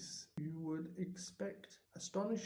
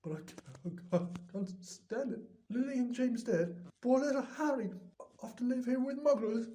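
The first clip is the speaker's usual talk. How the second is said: rising to a high, loud tearful wail; building from a choked start into loud grief; 6 semitones higher, 4 dB louder than usual. Acted. Hagrid grieving over Harry.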